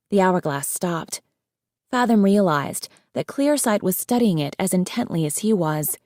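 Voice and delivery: usual speaking voice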